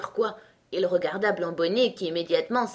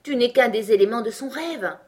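higher, louder scornful statement; contemptuous put-down; 5 semitones higher, 6 dB louder than usual. A narrator's reading. Tweedledee belittling Alice, contemptuous. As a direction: put her down with mild scorn. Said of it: "d'un ton méprisant".

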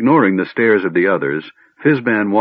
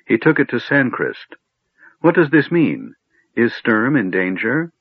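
higher, anxious questioning; fearful; 4 semitones higher than usual. A narrator's reading. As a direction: press for answers with a touch of fear. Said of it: "Lorana interrupted fearfully".

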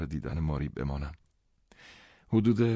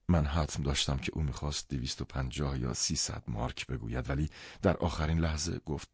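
usual speaking voice

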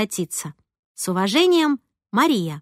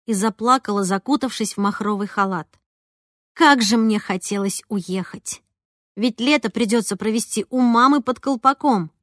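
plain reading voice

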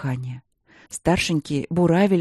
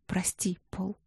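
whispered apology; faint whispered plea; 11 dB quieter, slower than usual. A narrator's reading. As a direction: breathe the sorry out. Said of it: "пролепетала она".

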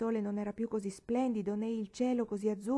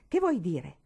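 louder startled cry; shout; 7 dB louder than usual. Acted. Paola caught stealing the cake, a louder startled cry. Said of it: "gridò".

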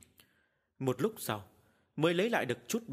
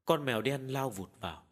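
plain reading voice

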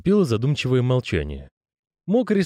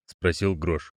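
usual speaking voice

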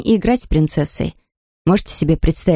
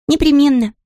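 put-on high child voice; 8 semitones higher than usual. Maya answers.

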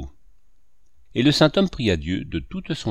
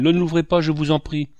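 higher, louder pleading cry; frightened cry; 5 semitones higher, 4 dB louder than usual. A narrator's reading. Peddler boy begs the priest not to open the box, frightened cry. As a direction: beg him in a panic not to open it. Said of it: "s'écria-t-il".

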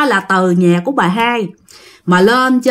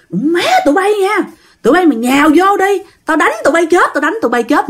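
high-pitched mock cursing; retold cursing threat; 7 semitones higher than usual. Possessed father cursing those holding him back, threatening. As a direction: curse them out in a raised pitch, as storytelling. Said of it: "ba mới chửi".

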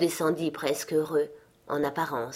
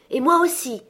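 higher, louder shout of me too; eager cry; 9 semitones higher, 5 dB louder than usual. A narrator's reading. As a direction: call it out eagerly, stress on moi. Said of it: "Tous répétèrent".

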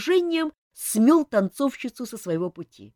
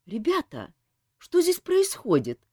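high, puzzled question; bewildered; 7 semitones higher than usual. A narrator's reading. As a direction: ask in bewilderment as you walk in. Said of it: "недоуменно вопросила Ольга".

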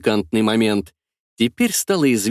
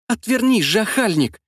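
demanding; 9 semitones higher than usual. A narrator's reading. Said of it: "потребовала Анна".

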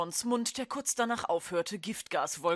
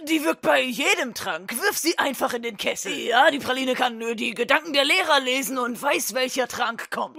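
loud sales pitch; 3 semitones higher, 8 dB louder than usual. Acted. Twins push the sale.

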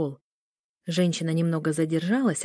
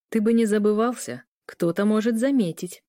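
ordinary delivery

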